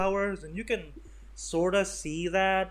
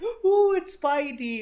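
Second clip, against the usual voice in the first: much higher and louder squeal of delight; mock thrilled fan; 13 semitones higher, 7 dB louder, slower than usual. Fans spotting Spider-Man, mock squealing excitement.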